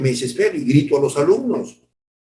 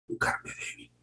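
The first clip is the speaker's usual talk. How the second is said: whispered; 13 dB quieter than usual. Acted. Verse repeated to each member.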